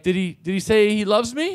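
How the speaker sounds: lightly put-on woman's voice rising in pitch; mock pleading woman. Woman asks officer about her boyfriend, mock pleading. Retold drunk woman asking hopefully.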